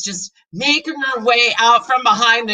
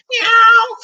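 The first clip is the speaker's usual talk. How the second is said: high loud meow; cat meow; 14 semitones higher, 8 dB louder than usual. Acted. Cat meowing in the kitchen, high meow. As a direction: mew sharply.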